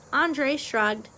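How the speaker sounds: plain reading voice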